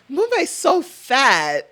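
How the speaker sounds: put-on high, drawn-out girly gossip voice; exaggerated high-pitched mimicry; mock male-centered friend. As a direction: squeal it slowly, imitating a catty friend. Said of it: "then she'll be like".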